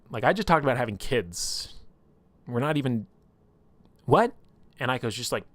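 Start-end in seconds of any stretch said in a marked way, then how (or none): 0.1-4.3 acted disbelief, rising to a loud what; incredulous. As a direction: splutter in mock bewilderment. Incredulous reaction to retold proposal.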